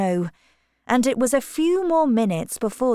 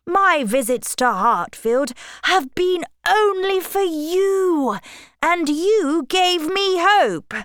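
impassioned protest; 8 semitones higher than usual. A narrator's reading. Rejected suitor protests in acted quarrel.

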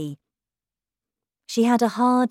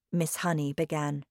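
plain reading voice

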